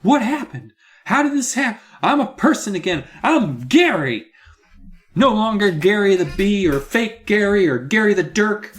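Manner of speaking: high, loud joyful disbelief; hammed-up kid overjoyed to be human; amazed Gary. Boy back from being a bee, high and loud, overjoyed.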